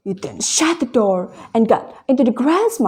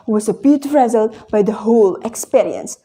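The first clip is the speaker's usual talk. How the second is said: plain reading voice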